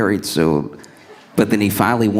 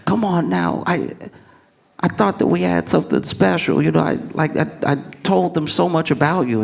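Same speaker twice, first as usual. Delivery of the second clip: high-pitched, indignant protest; a mildly hurt, worked-up suitor; mock mad; 8 semitones higher than usual. Rejected man reproaching his date, acted mild indignation. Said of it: "But then he gets mad".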